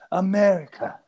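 playful high-pitched call; comic echo. Pretend. Echoes the comic cry.

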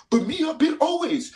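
high, loud fake-fan brag; mock bandwagon fan.

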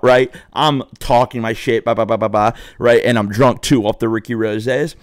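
normal talking voice

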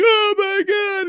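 very high, slow mock dismay; mock-horrified Kelly. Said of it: "And she's like".

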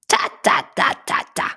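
loud breathy "ta, ta" clicks; tut-tut like a chiding bird; bird tutting. A narrator's reading. Bird clicking at the crying elephant, loud tutting sounds. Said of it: "machte der Madenhacker".

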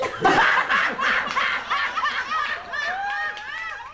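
very high giggling; high laugh. Real, not acted. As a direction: crack up. Laughs at own joke.

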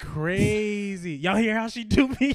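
loud disbelief. Real, not acted. Protesting at her laughing at him.

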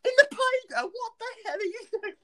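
high, fast, laughing outrage; squawking disbelief at a bench crash; mock incredulous shout. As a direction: yell in disbelief, barely holding a laugh. Brother yelling in disbelief.

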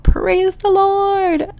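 high, long drawn-out hallelujah cry; mock jubilant relief. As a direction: stretch it out in thankful relief. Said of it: "I was just like".

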